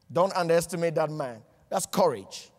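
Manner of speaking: ordinary talk, nothing marked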